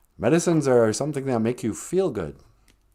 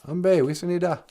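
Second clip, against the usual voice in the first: grandma calling; 6 semitones higher than usual. Retold or imitated. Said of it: "Grandma always said that".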